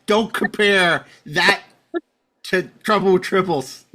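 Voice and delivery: a slow, drawn-out fake tantrum; mock outrage. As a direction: play it as a fan pretending to be wounded.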